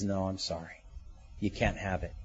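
low, soft, matter-of-fact denial; quiet refusal. Acted. God answering one who claims the blessing, quiet refusal. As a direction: turn them down quietly, pitch dropped.

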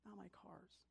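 tiny, high, whispery lament; heartbroken. Acted. Boy watching his cars get crushed, heartbroken. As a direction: whimper it very softly, as if heartbroken. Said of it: "his heart's breaking and he's like".

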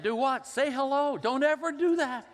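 very high, loud imitated protest; hammed-up phone squabble at full pitch; mock outraged exchange. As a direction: cry it out high, playing exasperated. Acted phone quarrel, retold.

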